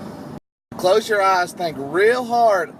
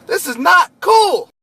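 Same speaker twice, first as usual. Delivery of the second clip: slow, high and loud exasperated shout; exasperated scolding; 12 semitones higher, 7 dB louder, slower than usual. Retold or imitated. Boss yelling at his driver over the phone, exasperated. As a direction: yell it at the driver who let you down.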